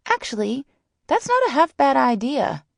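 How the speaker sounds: high, eager friend voice; high-pitched bright suggestion; Sally's voice. A narrator's reading. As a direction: pitch it way up, taken with the idea. Sally's high voice seizing the idea.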